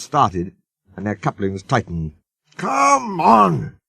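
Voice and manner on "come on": high, strained puffing; straining. A narrator's reading. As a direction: strain and puff hard. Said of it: "he puffed".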